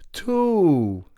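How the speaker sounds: high astonished answer; amazed. Acted. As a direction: blurt it in wonder. Pig amazed.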